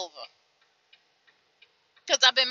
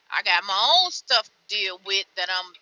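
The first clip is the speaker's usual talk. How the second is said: ordinary delivery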